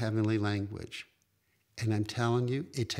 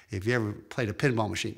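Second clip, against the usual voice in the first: normal talking voice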